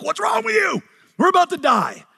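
high-pitched, hammed-up frantic shout; panicked yelling. Disciples waking Jesus in the storm, panicked shout. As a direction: cry out in mock panic, voice shooting up.

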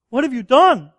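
a betrayed husband torn between rage and hurt; anger and hurt. Retold or imitated. Husband confronting his unfaithful wife, angry and hurt. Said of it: "somewhere in between deep anger and deep hurt".

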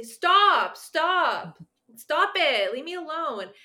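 high, loud, fed-up shouts of stop; frustrated yelling kid. Retold or imitated. A client yelling at a classmate to stop, loud and fed up. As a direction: yell stop at the kid bugging you, then fade on the last words. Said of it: "they'll just say".

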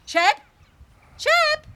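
high, loud searching call; loud high call for the dog. Acted. Calling the dog.

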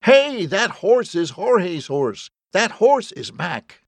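high, slow, drawn-out shout; insistent hollering across a yard. A narrator's reading. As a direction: shout each word out slowly to get attention. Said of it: "He shouted over and over again".

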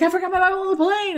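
high, loud, fast pleading; frantic plea. Retold or imitated. To French guards, high fast frantic plea. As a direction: rush it out, desperate to be understood.

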